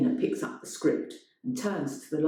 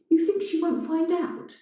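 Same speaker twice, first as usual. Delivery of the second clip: high, pointed threat; higher, taunting; challenging; 13 semitones higher than usual. A narrator's reading. Elena threatening.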